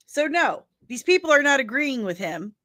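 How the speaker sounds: ordinary delivery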